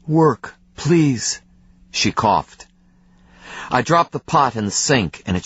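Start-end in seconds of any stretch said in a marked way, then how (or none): 0.1-1.4 thin, pleading and worn out; broken, fading plea. A narrator's reading. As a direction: beg with what little breath is left. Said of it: "A broken voice".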